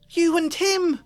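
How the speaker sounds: high, loud accusing cry; frantic accusation. A narrator's reading. Hermione accusing Lupin.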